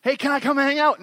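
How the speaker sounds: high, loud eager little-brother ask; eager kid sibling. Retold or imitated. Pastor acting out a younger sibling begging older ones, high eager voice. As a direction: plead to tag along.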